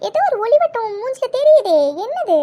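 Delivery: sing-song mock curiosity; teasing. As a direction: tease her about the glow. Teasing friend about glowing face.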